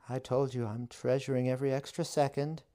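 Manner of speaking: high, fast witch voice. A narrator's reading. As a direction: rattle it off, pitched high and quick. Witch voice held.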